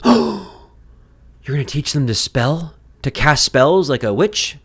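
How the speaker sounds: a hammed-up pearl-clutching critic; mock shocked gasp. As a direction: act horrified at the word spelling. Scandalized objector in a skit.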